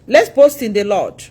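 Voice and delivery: ordinary talk, nothing marked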